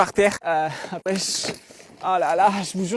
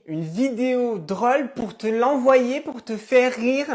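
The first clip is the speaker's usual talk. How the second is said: incredulous drawn out; 4 semitones higher, 4 dB louder, much slower than usual. Acted. Incredulous mother.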